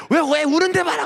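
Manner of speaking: a high, mock-indignant girl's retort; indignant friend. Friend scolds the pastor for upsetting a crying girl, mock indignant. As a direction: squeal it back in defence of her crying friend.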